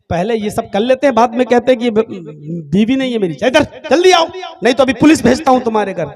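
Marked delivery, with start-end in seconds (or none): 3.4-6.1 loud and rushed, threatening to send the police; angry doctor shouting down the phone. Acted. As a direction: bark the order, then race through the threat.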